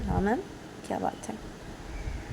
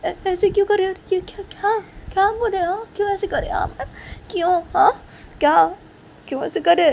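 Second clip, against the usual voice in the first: much higher, loud tearful pleading; crying student; 10 semitones higher, 6 dB louder than usual. Acted. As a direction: sob out the questions. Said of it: "तो काजल".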